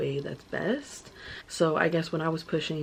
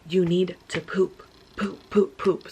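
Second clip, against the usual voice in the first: louder retold coaching yell; 5 dB louder than usual. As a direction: bark it like a birth coach. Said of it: "she was yelling it".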